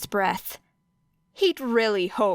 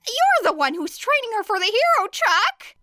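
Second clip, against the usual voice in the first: higher, rising indignant protest; Hizashi protesting; 12 semitones higher, 3 dB louder than usual. Acted. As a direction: push the blame back, getting higher. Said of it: "Hizashi spluttered".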